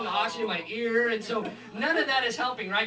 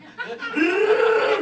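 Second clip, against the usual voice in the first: a big burst of real laughter, louder and higher; 9 semitones higher, 7 dB louder than usual. Real, not acted. Comic laughing loudly at own story. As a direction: laugh out hard at your own joke. Loud laugh after bit.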